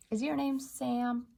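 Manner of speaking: ordinary talk, nothing marked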